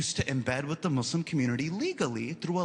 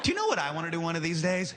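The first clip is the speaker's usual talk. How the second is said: fast teasing question; faster than usual. Retold or imitated. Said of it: "I go".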